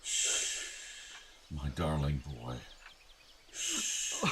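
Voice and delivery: very soft, whispered shushing; tender hush. Acted. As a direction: soothe him like a crying child. Father hushes his weeping son.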